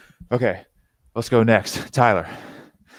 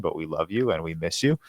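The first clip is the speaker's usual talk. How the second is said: ordinary delivery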